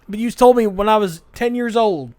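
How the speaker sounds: loud, high imitation of a let-down son; mock wounded protest. Son reminding Dad of his advice, mock wounded protest.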